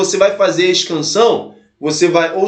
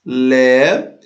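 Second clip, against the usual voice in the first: "lé" held long, low and rising; low, lingering "lé" that lifts at the end; isolated syllable; 8 semitones lower than usual. Shown as a demonstration. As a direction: draw "lé" out as a lone syllable. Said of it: "que eu ia separar".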